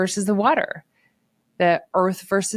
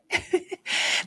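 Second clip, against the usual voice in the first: breathy laugh; 12 semitones higher, 6 dB quieter than usual. Real, not acted. Laughs after mimicking husband.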